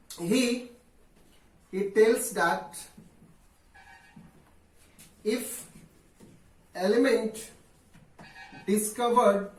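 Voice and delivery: word by word, long pauses between; slow dictation. Real, not acted. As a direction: dictate it slowly for students writing it down. Dictates while writing on board. (धीरे-धीरे, रुक-रुक कर लिखवाते हुए)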